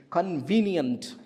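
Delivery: "Convenient" drawn out slowly, stressed on VE; stress on second syllable. Shown as a demonstration. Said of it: "Second syllable".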